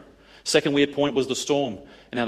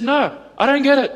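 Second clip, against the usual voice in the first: exasperated; 11 semitones higher, 5 dB louder than usual. Retold or imitated. Exasperated reply to coworker.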